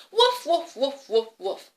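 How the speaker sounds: loud playful woofs, falling away; dog bark. Pretend. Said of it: "Can you bark like the dog?".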